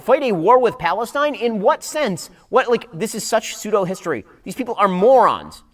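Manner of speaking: ordinary talk, nothing marked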